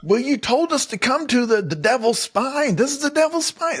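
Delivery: mock Wild Bill protest. Wild Bill arguing back at Buck, high whiny mock protest. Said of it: "Wild Bill's even like".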